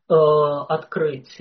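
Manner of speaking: normal talking voice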